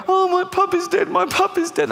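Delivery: very high mock child's lament; wailing child. Niece finding her puppy dead, high mock wail. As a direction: wail it in a squeaky little voice. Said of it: "they always come down the next day".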